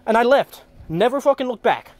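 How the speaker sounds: normal talking voice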